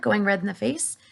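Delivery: ordinary delivery, nothing marked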